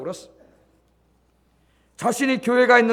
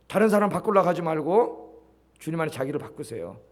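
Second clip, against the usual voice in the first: ordinary talk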